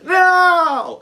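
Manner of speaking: very high loud refusal; hammed-up desperate no; defiant shout. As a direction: scream no at the staff. Refusing staff while searching.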